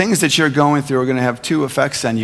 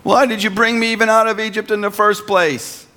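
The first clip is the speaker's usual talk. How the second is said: much higher, accusing whine at God; whining believer; 10 semitones higher than usual. Retold or imitated. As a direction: act out the bitter complaint. Mocks believers blaming God.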